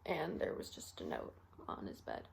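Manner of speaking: hushed, halting account of a death; quiet, reluctant confession; subdued grief. Acted. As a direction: speak softly, with long heavy pauses. Acted recollection of finding patient dead.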